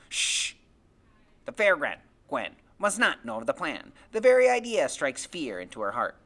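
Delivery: loud, theatrical warning; conspiratorial, grandiose Freak. Acted. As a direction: hush him, then proclaim it like a melodrama. Narrator voices Freak, conspiratorial and grand.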